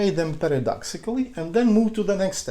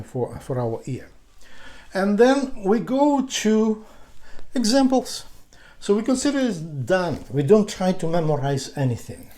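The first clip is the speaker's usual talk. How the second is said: ordinary talk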